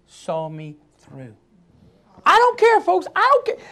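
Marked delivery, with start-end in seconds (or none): 2.2-3.7 high, emphatic defiance; preacher's high defiant exclamation; defiant outcry. Real, not acted.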